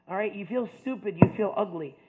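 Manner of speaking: ordinary delivery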